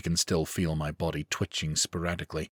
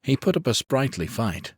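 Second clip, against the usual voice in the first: ordinary delivery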